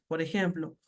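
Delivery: ordinary talk, nothing marked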